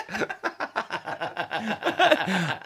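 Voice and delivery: real burst of laughter; helpless, trailing laughter; hearty laugh. Real, not acted. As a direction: laugh it out after the joke. Both laugh at the reception bit.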